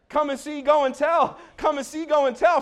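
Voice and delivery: high, punchy repeated call; rousing preacher's refrain. Real, not acted. Preacher sending the congregation out to tell, rousing call.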